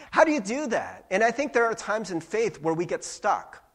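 ordinary talk

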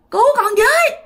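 very high, loud cry for help; finally screaming. Retold or imitated. Dreamer finally shouting to wake up, high loud scream. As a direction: break through with a scream. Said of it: "bạn la lên được rằng".

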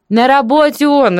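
put-on high, cheerful old neighbour; lilting high, pleased voice; joyful reply. A narrator's reading. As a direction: answer gladly, pitched well up. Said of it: "радостно отвечала тётка".

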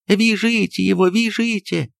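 high urgent yell; maid's shout. Acted. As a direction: cry out from the doorway. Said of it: "закричала высунувшаяся из дверей Аннушка". (высоко и громко кричит из дверей)

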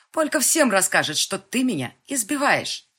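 high, loud threatening cry; cornered girl shouting a threat; desperate shout. Acted. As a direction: yell it to scare him off.

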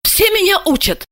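high-pitched inner protest; indignant. A narrator's reading. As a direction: complain to yourself, affronted. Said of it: "мысленно снова возмутилась она". (возмущённо, про себя)

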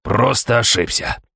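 choked, strained croak; strangled croak. Acted. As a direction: force it out through a tight throat. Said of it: "сдавленно каркнув".

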